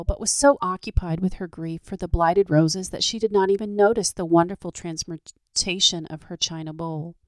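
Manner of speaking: ordinary delivery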